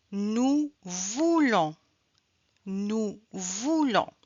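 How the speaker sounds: "nous voulons" slow, with stress on voulons; slow drill. Shown as a demonstration. Teacher slowly modelling the on sound for learners. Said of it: "we can hear the on sound".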